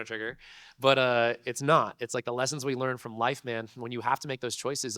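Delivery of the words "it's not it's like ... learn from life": ordinary talk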